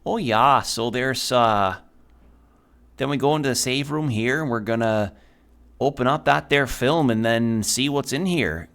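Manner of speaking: put-on Midwest accent drifting towards Irish; attempted Minnesota accent. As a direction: narrate like a folksy Minnesotan. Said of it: "trying not to slip into Irish when I do that".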